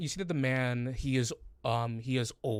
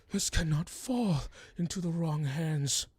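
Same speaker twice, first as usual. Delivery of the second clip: a hint of the old man's higher urgent voice; lightly voiced old man; 5 semitones higher than usual. The GM repeats the old man's warning, lightly voiced. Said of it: "He goes".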